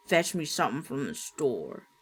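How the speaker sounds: low rasping grandpa; raspy voice. Acted. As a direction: rasp it through smoke. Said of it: "he rasped".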